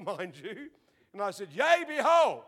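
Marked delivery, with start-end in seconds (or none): 1.5-2.4 high, loud proclamation; loud prophetic call. Retold or imitated. Teenager bursting out prophesying in church, loud and high. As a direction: boom it out like a prophet. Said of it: "much of my pastor's shock".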